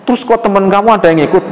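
high, pressing mother's voice; questioning mother. Acted. Mother asks why his friend went, high and pressing. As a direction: press the son for answers.

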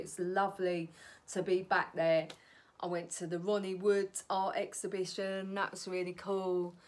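ordinary talk

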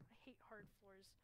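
soft whispered aside; scared whisper. Real, not acted. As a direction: whisper it so nothing hears.

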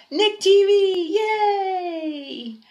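high, slow show-title announcement with a cheer. Acted. Kid announcing his own show, a high slow cheer. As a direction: belt out the show name like a jingle.

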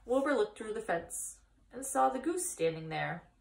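ordinary delivery, nothing marked

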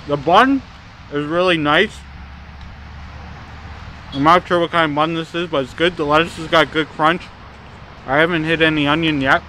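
speaking between bites of burger; talking while chewing. Real, not acted. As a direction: mouth partly full of food. Seen 1: Bites burger at -1, chews and talks with full mouth after.

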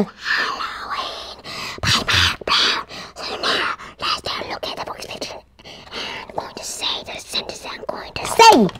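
soft unvoiced whisper. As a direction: breathe the words in a whisper. Whispered intro to reading.

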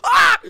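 sudden loud yelp of surprise; startled shout. Real, not acted. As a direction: jolt out a sharp cry.

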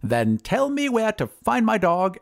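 high, slow wizard voice; high, drawn-out wizard; bargaining wizard. Acted. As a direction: demand it slowly in a high wizard's voice. Wizard demands his dog.

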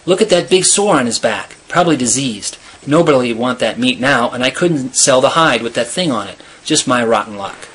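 plain reading voice

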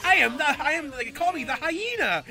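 high, loud hammy villain voice; cheesy dub villain. Retold or imitated. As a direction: ham it up like a bad dub. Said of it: "Bellamy's voice in the four kids dub".

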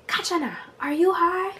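very high mock-mom voice; imitating mom. Mom asks daughter again, imitated high accusing voice. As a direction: question it high, like an accusing mother.

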